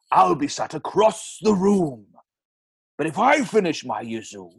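Plainview read in Welsh; Welsh accent. Acted. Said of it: "that Welsh accent".

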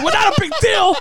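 very high mock-outraged squeal; incredulous retort. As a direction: throw it back in disbelief, pitch soaring. Retells his outraged retort.

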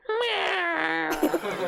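high, whiny cat cry; defeated meow. Retold or imitated. The caught cat giving up, a high pitiful meow. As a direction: mew like a beaten cat.

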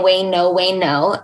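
usual speaking voice

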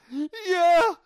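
high, loud mock wail; terrified wife. Terrified wife asks for movie off, mock wail. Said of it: "she's fucking terrified".

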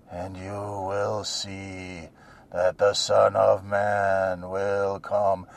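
low, slow, soft, dreamy tripping voice; spaced-out Jesus. Retold or imitated. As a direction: gaze at the horizon and drift the words out. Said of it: "like he's just studying the horizon with his weird blue eyes going".

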